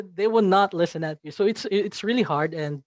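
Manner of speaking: usual speaking voice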